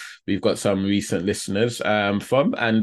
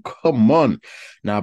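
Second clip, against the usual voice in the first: ordinary delivery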